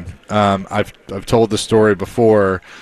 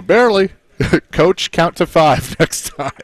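high-pitched, through laughter; amused punchline, laughing as you say it; laughing retold comeback; 8 semitones higher than usual. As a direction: crack up while retelling your own quip. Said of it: "we're all laughing".

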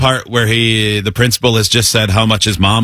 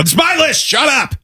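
high mock yell; mock shout; 13 semitones higher than usual. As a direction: yell like a bratty kid. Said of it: "Not all the screaming going".

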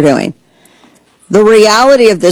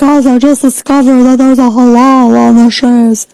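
higher-pitched imitation of an alarmed poster; mocking woman's voice; 6 semitones higher than usual. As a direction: mimic her big discovery in a put-on voice. Imitating a woman who posted online.

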